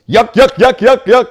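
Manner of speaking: high, loud goofy yuck yuck laugh; mock goofy laugh.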